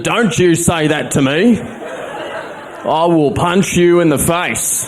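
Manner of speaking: slow, high mock threat; slow, high impression of an angry old politician; Bob Katter. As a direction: drag out a gruff old MP's threat. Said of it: "he responded".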